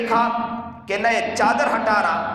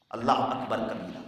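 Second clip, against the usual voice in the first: much softer, lower murmur of praise; hushed awe; 12 semitones lower, 9 dB quieter than usual. Real, not acted. Preacher overcome before the congregation, hushed. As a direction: breathe it out in awe. Awed exclamation.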